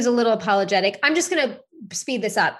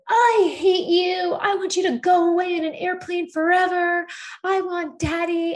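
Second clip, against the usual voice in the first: put-on child's tantrum; 10 semitones higher than usual. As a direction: imitate a kid wailing at his mother. Voices son's tantrum at mother.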